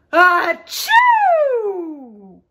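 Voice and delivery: loud, explosive achoo with long tail; big storybook "ah-choo"; huge bear sneeze. Acted. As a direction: sneeze hugely, drawing out the end.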